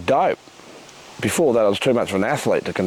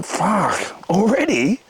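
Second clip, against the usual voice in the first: mock surprise; 8 semitones higher, much slower than usual. Stoned mate protesting his turn.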